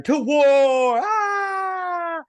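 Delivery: very high, loud war shout; battle cry. Retold or imitated. Crowd rallies for war, very high loud shout. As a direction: bellow it to rally troops.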